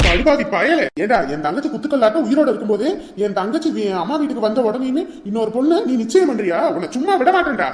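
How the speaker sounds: loud, high raging threat; hammed-up fury; furious rage. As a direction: tear into the cheating groom. Brother rages at Ravi.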